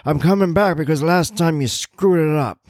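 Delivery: put-on high, querulous old man voice; cranky old man.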